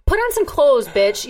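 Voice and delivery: high, loud exasperated yell; angry outburst. Real, not acted. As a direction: yell it at her in disgust. Ranting about Kim Kardashian.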